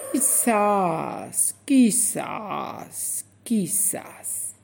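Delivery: fading to a breathless last word; slow old turtle. Acted. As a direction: drawl it out like an ancient turtle. Old turtle answers slowly.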